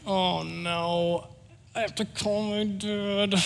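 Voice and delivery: small, high, soft whimper; retold kid's dread, high and hushed; mock dread. Boy stranded with broken bike dreads calling dad, mock whimper. Dreading the call home, in story.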